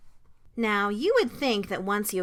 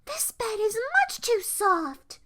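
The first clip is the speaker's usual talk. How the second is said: moaned complaint; 11 semitones higher than usual. A narrator's reading. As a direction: whine it high and fussy. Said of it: "she moaned as she sank".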